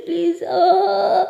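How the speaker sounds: crying plea. Acted. Pari breaking down in tears.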